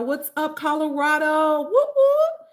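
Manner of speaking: loud, high whooping; high, loud party holler; excited cheer. Real, not acted. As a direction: cheer the state on with rising whoops. Cheering a viewer's home state.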